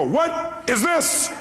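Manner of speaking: high, drawn-out confrontational call; exaggerated, slow, high-pitched challenge; mock exasperation. Acted triumphant finder confronting searcher.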